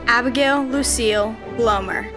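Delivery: ordinary talk, nothing marked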